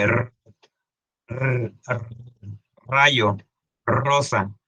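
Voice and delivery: "r" trilled alone, then in "rayo, rosa"; rolled R. Shown as a demonstration. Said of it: "el de la R".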